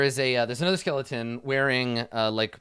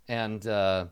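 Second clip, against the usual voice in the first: ordinary talk, nothing marked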